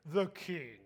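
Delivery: put-on smug reveal; playful, hammed-up bragging; mock boast. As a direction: drop the title grandly for a laugh.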